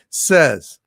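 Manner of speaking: ordinary talk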